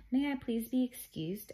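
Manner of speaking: high, sweetly polite request. A narrator's reading. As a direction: ask teacher as politely as possible. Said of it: "as politely as he knew how".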